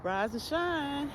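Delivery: high, drawn-out, singsong wake-up; mock-sweet "time to get up" voice, stretched out high; cheery wake-up call. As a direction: imitate a too-sweet morning wake-up call, slowly. Gentle wake-up call being mocked.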